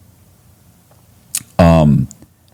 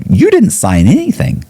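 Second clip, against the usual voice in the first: pointed; 13 semitones higher than usual. Retold or imitated. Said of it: "He's like".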